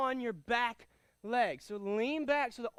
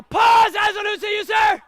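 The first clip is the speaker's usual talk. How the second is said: high, loud shouted order; loud command; 10 semitones higher, 18 dB louder than usual. Real, not acted. Instructor shouting at the class to stop and look. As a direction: stop the whole room with it.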